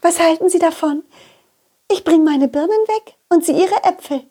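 high, dreamy lady donkey, a bit dizzy; dazed lady donkey. Acted. Lady donkey after bumping heads with the donkey, dazed and high. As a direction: she just bumped heads and shakes it off.

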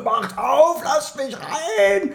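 high, far-off mock cry through the door; pleading yell. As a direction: beg to be let in, hammed up. Retold friend hammering on locked door.

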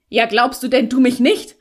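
loud, heated comeback; angry retort. A narrator's reading. Felix glaring at the girl, loud angry retort. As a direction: fire it straight back at full volume. Felix explodes back at her.

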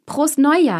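high, loud New Year toast; festive toast. A narrator's reading. Party guests toasting each other, loud and bright. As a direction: raise the glass and call it out. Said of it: "hieß es".

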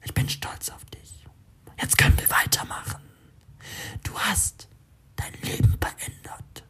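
whispered voice inside his head; whispery telepathic voice. A narrator's reading. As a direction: breathe it like a voice in his mind. Inner voice praises him.

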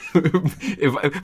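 short amused laugh. Real, not acted. Host laughs at flat greeting.

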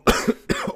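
hard cough after swallowing wrong; choking cough. Real, not acted. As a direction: cough like something went down wrong. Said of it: "ich hab mich grad fett verschluckt".

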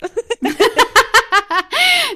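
high bright burst of laughter. Real, not acted. Laugh after joke. (hohes Lachen)

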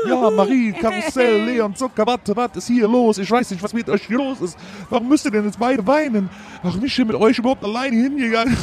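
exasperated, rising desperation; overwhelmed father. Acted. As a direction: babble helplessly at crying children. Father overwhelmed by crying children.

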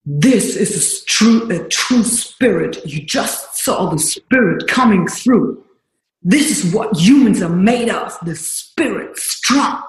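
slow, solemn English speech to the room; coach's praise. Retold or imitated. Coach announcing her true spirit to the room, slow and solemn. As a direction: lay it down slowly like a moved mentor.

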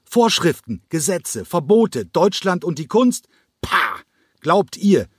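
high, furious scream. A narrator's reading. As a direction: hurl the words like the chair. (Cranko schreit seine Verachtung hoch und wütend heraus)

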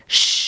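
long hissed storm wind; whooshing wind. Acted. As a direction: imitate wind with a drawn-out shh. Imitates the winter storm. (langes Windrauschen)

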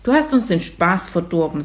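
ordinary delivery, nothing marked

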